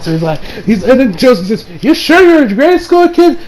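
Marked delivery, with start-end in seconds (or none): 1.8-3.4 high-pitched retold disbelief; amazed question. Josuke asking Hayato after the brave rescue, amazed question.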